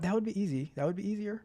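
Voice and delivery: ordinary talk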